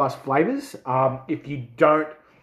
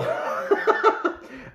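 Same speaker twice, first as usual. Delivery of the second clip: high, breathy wheezing laugh; helpless laugh. Real, not acted. Reviewer unable to get words out, laughing helplessly. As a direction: crack up mid-sentence. Overcome by heat mid-sentence.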